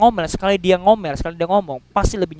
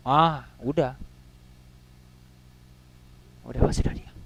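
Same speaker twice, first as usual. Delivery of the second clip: hushed; 7 semitones lower, 7 dB quieter than usual. Retold or imitated. Class falls silent after warning.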